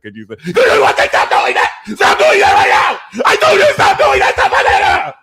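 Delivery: loud, high furious yell; hammed-up blowup after holding it in; exploding earth sign. Earth sign finally snapping, loud furious yell. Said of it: "slow to anger".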